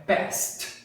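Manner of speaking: "passed" as one syllable ending in voiceless S-T; unvoiced ending. Shown as a demonstration. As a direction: close "passed" on a T sound. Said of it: "Não tem voz". ("passed" com som de T, sem voz)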